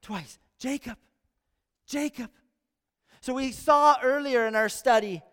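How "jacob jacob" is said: soft, intimate repeat of the name; quiet tender calling. Shown as a demonstration. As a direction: say his name gently, close by. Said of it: "the Lord calls out Jacob twice".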